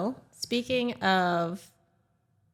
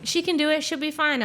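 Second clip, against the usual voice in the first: higher, easygoing vouching; breezy encouragement; 7 semitones higher than usual. Retold or imitated. Brother-in-law backing her dirt bike ride, breezy encouragement. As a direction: imitate an overconfident brother-in-law.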